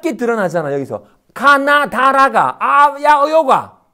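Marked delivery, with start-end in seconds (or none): 1.3-3.8 loud, high chant of syllables; shouted syllable demonstration. Shown as a demonstration. Teacher showing sounds arising from awareness, forceful shouting. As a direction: belt out each sound emphatically.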